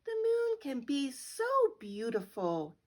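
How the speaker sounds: ordinary delivery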